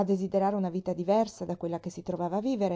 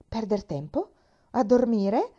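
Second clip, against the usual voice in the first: astonished questions, the second higher and louder; incredulous exclamation; 3 semitones higher, 5 dB louder, slower than usual. A narrator's reading. Sick woman echoing the girl on wasting time sleeping, incredulous. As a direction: repeat it in rising disbelief. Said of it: "esclamò la donna".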